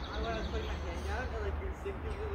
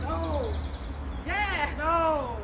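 excited yelling; 6 semitones higher, 5 dB louder, much slower than usual. Real, not acted. Yelling during the game.

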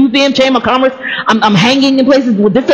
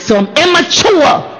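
drawn out, raised, insistent retort; 6 semitones higher, slower than usual. Retold or imitated. Student pressing mentor for a verdict, insistent. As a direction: press the point slowly, pitched up. Insisting to her again, she laughs.

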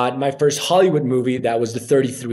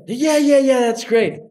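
mock enthusiasm; 10 semitones higher, 3 dB louder than usual. Mimicking eager casting people.

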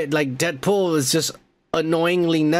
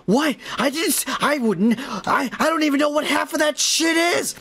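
high-pitched, stammering retold protest; mock flustered denial; 9 semitones higher than usual. Johnny Storm protests Deadpool's made-up story, mock flustered. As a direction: sputter it out high like someone wrongly accused.

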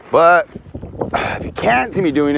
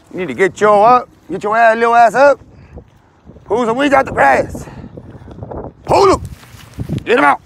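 mock scolding; 8 semitones higher than usual. Scolding viewers about backyard weeds.

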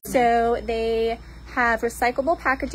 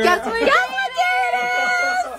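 excited shout. Real, not acted. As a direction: hype up the crowd. Hyped opening call to camera.

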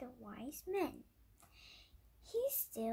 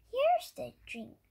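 put-on loud, high wise old man; wise old sage; 10 semitones higher, 10 dB louder than usual. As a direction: boom it out like a proud sage. Wise man gives drink.

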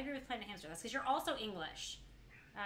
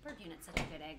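normal talking voice

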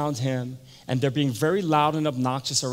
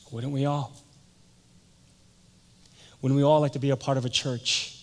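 normal talking voice

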